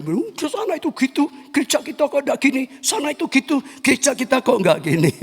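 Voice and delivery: high whiny mocking voice, pushed up and quick; mock complaining churchgoer. Preacher mimics a church member comparing churches, high whining mockery. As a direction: sneer like a member who envies other churches.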